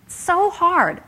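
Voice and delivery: high, drawn-out exasperated whine; mock whining. Line managers complaining about staff retention, high mock whine. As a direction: mimic a fed-up manager moaning, stretching out so. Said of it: "come up against when I start talking about employee retention".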